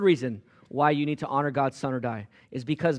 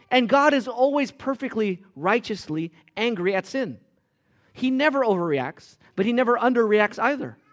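loud, high, emphatic sermon build; impassioned preaching; 8 semitones higher, 5 dB louder than usual. Acted. Preacher urging the congregation with loud, impassioned preaching. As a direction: press the point hard and loud. Preacher drives home point on anger.

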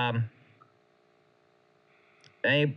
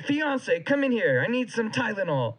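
pained call; 8 semitones higher than usual. Retold or imitated. Retold call to fiance, high.

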